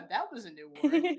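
real laugh at own slip; amused, laughing self-mockery. Real, not acted.

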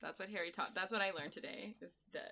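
soft, hushed wrap-up; quiet mock-solemn. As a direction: drop to a hushed lesson tone. Mock lesson thanking Harry.

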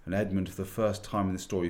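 usual speaking voice

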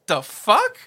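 retold as a mildly confrontational challenge; acted-out indignant question; intimidating tone. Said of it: "See, that tone right there".

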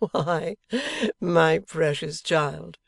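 amused husband with a chuckle before it; laughing outright. A narrator's reading. Husband answers his wife's suspicion, amused. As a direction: let a laugh lead into a slow endearment. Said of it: "laughing outright".

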